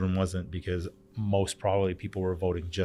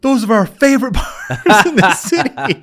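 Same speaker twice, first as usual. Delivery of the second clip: much higher, louder, laughing delight; gushing fan; 14 semitones higher, 13 dB louder than usual. Retold or imitated. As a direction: gush over his bars with a laugh. Agents gush about his bars.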